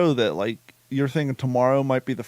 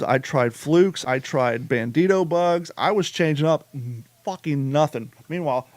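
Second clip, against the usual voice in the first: normal talking voice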